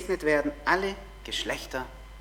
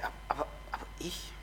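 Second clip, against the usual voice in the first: fast, hushed self-doubting whisper; doubtful whisper; 4 semitones lower, 15 dB quieter, much faster than usual. Pretend. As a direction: mutter the doubt under your breath. Zweifelnde Stimme des Zuhörers.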